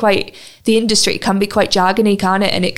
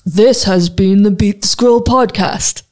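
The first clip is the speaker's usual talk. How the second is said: radio announcer voice; 3 dB louder, slower than usual. Shown as a demonstration. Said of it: "That's very radio".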